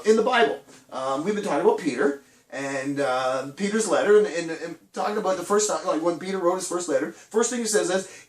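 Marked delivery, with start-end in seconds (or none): none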